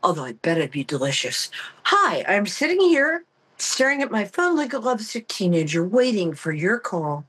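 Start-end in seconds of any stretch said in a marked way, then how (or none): none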